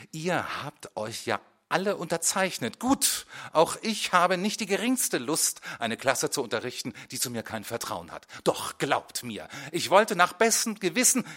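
rising anger at the class; angry speech. A narrator's reading. Teacher rebuking the pupils, loud and indignant.

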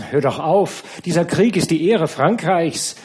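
lauter, höher, empört widersprechend; erregter Einwand. Acted. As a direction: fahr empört dazwischen. Streit der Frauen über den Krieg. (empört und laut)

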